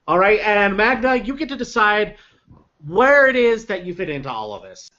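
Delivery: ordinary talk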